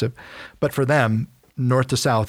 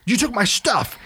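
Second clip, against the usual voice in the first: higher, aggrieved complaint; indignant whine; 10 semitones higher than usual. Retold or imitated. Micah to six hundred Danites, higher indignant whine. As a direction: sound wronged and a bit pathetic.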